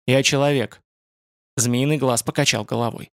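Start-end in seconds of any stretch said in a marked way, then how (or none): 0.1-0.8 sullen. A narrator's reading. Young man insists to the spider-man he is human, mildly sullen. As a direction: say it firmly with a touch of gloom. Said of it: "Ютланд ответил угрюмо".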